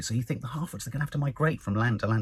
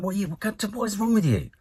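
higher-pitched, exasperated questioning; 9 semitones higher than usual. Acted. Reviewer berating the annoying stranger for moaning, exasperated. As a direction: demand answers in high-pitched exasperation.

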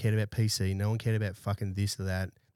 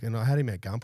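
usual speaking voice

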